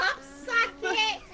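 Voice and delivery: high, slow teasing squeal; mocking exclaim. Real, not acted. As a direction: drag it out to tease her. Teasing friend's word mix-up.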